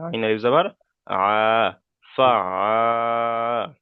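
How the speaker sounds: very slow "ফা আ" with a sustained open vowel; long vowel demo. Shown as a demonstration. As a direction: stretch the final "আ" in "ফা আ". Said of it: "এক আলিফ পরিমাণ টান দিতে হবে".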